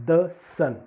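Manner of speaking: "the" with a short schwa, then sun; "the" as thuh. Shown as a demonstration. Teacher explains the consonant rule, "the" said "thuh". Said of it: "the pronunciation of the definite article".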